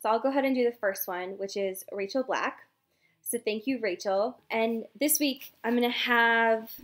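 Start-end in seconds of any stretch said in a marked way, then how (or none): none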